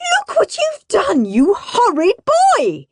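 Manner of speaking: high, cracking scolding yell; mother yelling at her son; angry yell. Acted. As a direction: shout at the boy in dismay. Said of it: "yelled Mum".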